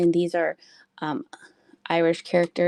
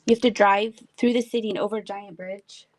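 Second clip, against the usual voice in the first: ordinary talk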